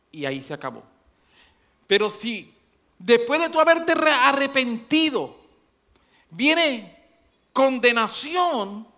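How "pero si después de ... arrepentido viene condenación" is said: loud, high preacher's build-up; preaching at full force. Acted. Preacher warning the congregation, loud and high. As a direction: drive the warning home to the church.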